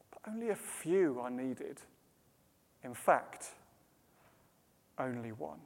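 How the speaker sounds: very soft, low, with long pauses; calm and gentle. Acted. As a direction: gently soothe someone who is overwrought. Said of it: "very calmly and very gently".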